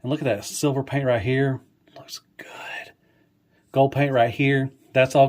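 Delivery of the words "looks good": breathy hushed whisper of awe. Real, not acted. Reviewer marveling at the silver paint, whispered. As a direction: whisper it as if the toy might hear.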